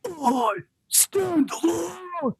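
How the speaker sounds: high, drawn-out wailing rock vocal. Acted. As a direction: belt it as a strained, slow rock-singer imitation. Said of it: "hear my best Godsmack impression".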